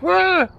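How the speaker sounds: a sudden high yelp, retold in fun; mock bark. To scare the ghost in the room, a mock high bark. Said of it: "I even barked out".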